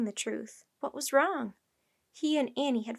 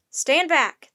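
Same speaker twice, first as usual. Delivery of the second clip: loud, raised-pitch order; yelling; 4 semitones higher, 11 dB louder than usual. A narrator's reading. Captain orders a child away, yelling. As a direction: yell a sharp command. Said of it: "the captain yelled at her".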